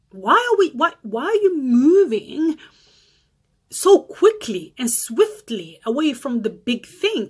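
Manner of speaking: a loud, slow "whoa, whoa" of alarm; shocked outburst. Retold or imitated. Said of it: "And I was like".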